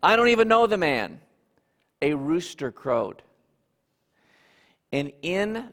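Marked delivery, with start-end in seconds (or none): 0.0-1.2 fast, louder exclaimed denial. Acted. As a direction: blurt it out in a heated rush. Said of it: "After the third time of exclaiming".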